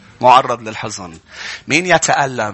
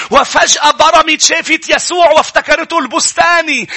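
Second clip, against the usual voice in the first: high, raised sermon voice; full-force preaching; 12 semitones higher, 5 dB louder than usual. Acted. Preacher tells the congregation of Mary at the tomb, full-force preaching.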